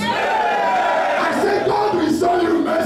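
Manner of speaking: shouted preaching over music; fiery preacher sermon. Acted. Preaching at full force.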